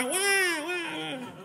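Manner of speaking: high, laughing imitation of a baby's cry; mock whimpering cry; mock baby wail. Imitating crying baby or dog.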